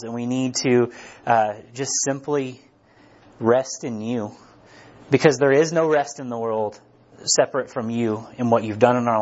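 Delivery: low, earnest prayer voice; closing prayer. Real, not acted.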